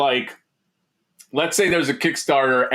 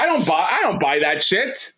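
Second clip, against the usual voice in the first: ordinary talk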